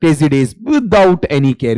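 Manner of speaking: drawn-out gloss of the phrase; slow explanation. Shown as a demonstration. Teacher glossing the phrase for the class, slowly. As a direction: spell it out slowly for students. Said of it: "slow explanatory reading".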